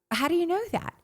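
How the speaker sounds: high-pitched, disbelieving question; astonished. Retold or imitated. Reacting to the practitioner's insight, astonished. Acts out her astonished reply.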